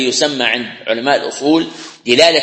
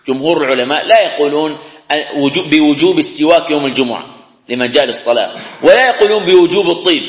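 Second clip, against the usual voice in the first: normal talking voice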